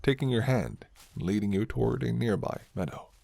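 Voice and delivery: ordinary delivery, nothing marked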